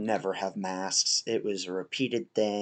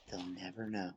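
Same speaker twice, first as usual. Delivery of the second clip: hushed secrecy; 2 semitones lower, 13 dB quieter than usual. Real, not acted. Player hiding from an enemy, hushed. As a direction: say it under cover, barely audible.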